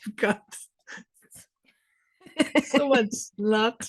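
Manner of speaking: high, slow amused teasing. Real, not acted.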